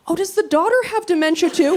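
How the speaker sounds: whisper-worried aside, lightly voiced; mock concern. Nurse asking other nurses about the daughter, mock pity. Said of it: "look at the other nurses like".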